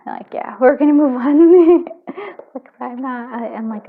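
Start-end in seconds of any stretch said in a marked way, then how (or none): 0.6-1.8 pushing words out through real tears; tearful, louder, slow. Real, not acted. Reader choking up, trying to move on, tearful and slow. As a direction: try to carry on while crying. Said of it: "I don't like to tear up".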